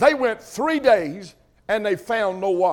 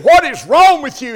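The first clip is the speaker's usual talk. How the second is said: louder and higher, "What is wrong with you?" demanded; professor's challenge; 4 semitones higher, 7 dB louder than usual. Retold or imitated. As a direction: fire the question at the boy. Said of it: "He said".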